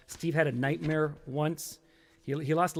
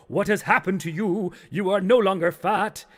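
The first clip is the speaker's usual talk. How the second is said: loud, higher theatrical intoning; accusing phantom chorus; 6 semitones higher, 7 dB louder than usual. Acted. Beer, pizza and pants confront Steve, accusing chorus voice. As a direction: confront him as one haunting chorus. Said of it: "And they all go".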